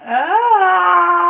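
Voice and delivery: long, high, loud "ah!"; cry of pain. Real, not acted. Streamer hurting badly on a live, sharp pained cry.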